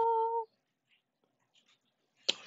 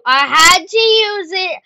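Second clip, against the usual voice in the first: slow, louder, justifying; loud defensive; 7 semitones higher, 16 dB louder than usual. Real, not acted. Gamer justifying a power-up, loud insist. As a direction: insist loudly on the move.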